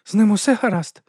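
high, loud anxious question; frightened, high-pitched urgency; trembling voice. A narrator's reading. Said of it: "Її голос дрижав".

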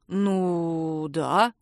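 low, drawn-out reluctant admission; sheepish. A narrator's reading. Man admitting his slip to lover, sheepish. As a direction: own up reluctantly, stretching it out.

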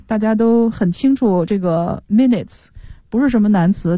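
ordinary talk, nothing marked

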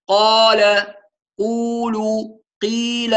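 قال, قولوا, قيل with a firm voiced qaf; the qaf in قال voiced and full; correct qaf. Shown as a demonstration. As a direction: recite each qaf word firmly. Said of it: "هكذا".

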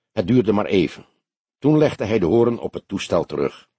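plain reading voice

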